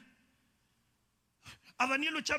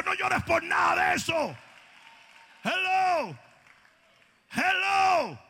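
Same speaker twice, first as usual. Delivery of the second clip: loud, rising sermon shout over music; fiery preaching; 3 semitones higher, 4 dB louder, slower than usual. Acted. Preacher shouting hope to the heartbroken, fiery. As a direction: preach it out to the congregation.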